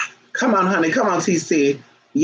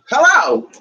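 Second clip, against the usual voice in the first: sharp shout; 9 semitones higher, 5 dB louder than usual. Real, not acted. Sharp outburst at dog.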